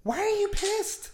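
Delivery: high, slow puzzled ask; genuinely baffled, drawn out; to the angry hairdresser, slow high puzzlement; puzzled question. Retold or imitated. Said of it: "I told her".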